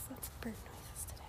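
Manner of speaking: whispered aside about the birds. Real, not acted. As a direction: breathe it out in a whisper. Quiet aside while walking.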